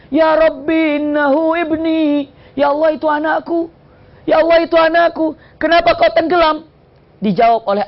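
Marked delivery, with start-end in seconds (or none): 0.1-6.7 anguished plea. Acted. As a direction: plead for a lost child, voice raised high. Noah pleads for drowned son.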